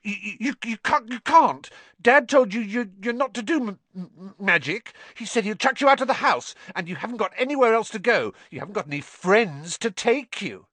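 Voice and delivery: high, panicky spluttering; stammer, then gabble the threats in alarm; frightened high-pitched blustering; panicked stammer. A narrator's reading. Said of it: "a look of panic".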